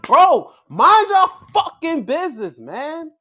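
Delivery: loud, high-pitched angry yell; angry outburst. Real, not acted. Viewer telling interfering wrestlers off, angry and loud. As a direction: blow up, punching the words. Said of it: "I got angry at this".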